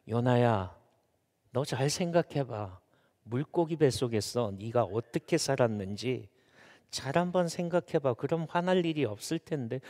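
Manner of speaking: soft, low admonition; God reasoning with Jonah. Acted. God urges Jonah to think back, soft low admonition. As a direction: reason with them quietly. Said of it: "하나님이 왜 이렇게 말씀하실까요?".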